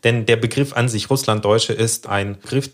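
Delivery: ordinary talk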